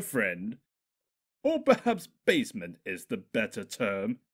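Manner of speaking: loud mocking sneer; sneering demon voice. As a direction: taunt him with a sneer. Stuart sneering reveal.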